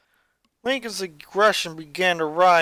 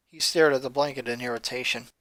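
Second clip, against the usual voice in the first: plain reading voice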